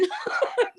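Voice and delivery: a quick high laugh; brief amused laugh; short laugh. Real, not acted. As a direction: laugh it off lightly. Laughing at own remark.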